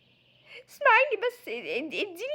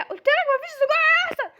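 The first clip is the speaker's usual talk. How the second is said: much louder and higher snapped refusal; 8 semitones higher, 8 dB louder than usual. Acted. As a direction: shut her down loudly. Refusing the sausage demand.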